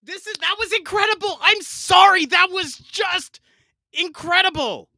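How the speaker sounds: high-pitched, real gushing excitement; blown away. Real, not acted. Host raving to co-host about the song, blown away. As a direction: rave about it, voice lifted high.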